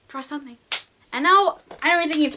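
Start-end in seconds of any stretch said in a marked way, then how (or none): 0.1-0.6 "Draw something" said again much softer, almost under the breath; soft repeat. Shown as a demonstration. Vlogger nudging hesitant viewers to draw, soft repeat. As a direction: drop "Draw something" to a near-hush.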